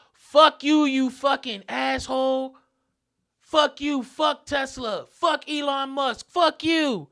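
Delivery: high, loud, drawn-out insults; retold scream. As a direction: yell it like an irate heckler across the street. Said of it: "He scream".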